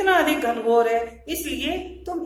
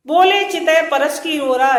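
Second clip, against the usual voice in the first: loud, raised-pitch verse declamation; verse recitation; 4 semitones higher, 10 dB louder than usual. Shown as a demonstration. Teacher reciting scripture verse to students, loud declamation. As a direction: declaim the line for a class.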